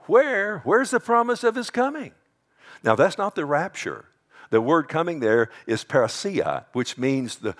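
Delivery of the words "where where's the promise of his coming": high-pitched scoffing question; mocking. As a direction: jeer it high and loud, like a scoffer. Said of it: "they're going to mock the sure".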